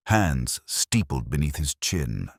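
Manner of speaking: ordinary delivery, nothing marked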